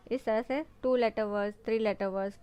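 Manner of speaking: ordinary talk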